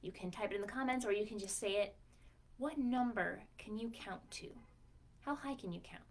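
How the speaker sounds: plain reading voice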